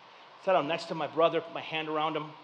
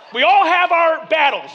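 laughing shout; 9 semitones higher, 10 dB louder than usual. Real, not acted. Laughing loud emphasis.

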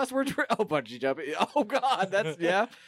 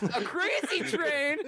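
slow, high, laughing drunk imitation; mock drunk voice; 8 semitones higher, much slower than usual. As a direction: drag it out, drunk-sounding. Mocking a friend's line.

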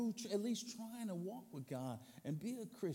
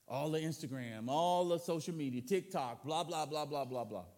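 ordinary delivery

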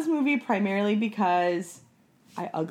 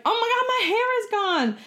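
mock panic; 5 dB louder, faster than usual. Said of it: "then she's like".

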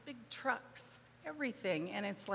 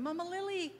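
imitated yell; 10 semitones higher than usual. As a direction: call out for her like an excited villager, voice pitched up. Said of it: "I hear somebody yelling".

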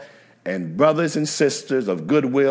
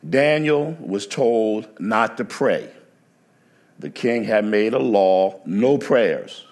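ordinary talk

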